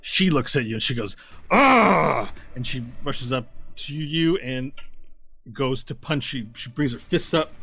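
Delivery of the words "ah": loud, high attacking yell; acted battle cry. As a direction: yell as you charge to punch. Tiefling charges at player.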